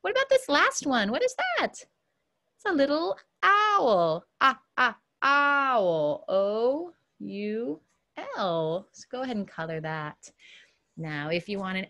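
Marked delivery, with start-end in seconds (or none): 3.4-6.2 "owl" stretched, with short "Ah, ah" between; sounding out. Shown as a demonstration. Teacher helping students hear owl's start, sounded out. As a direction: draw out "owl" and isolate its first sound.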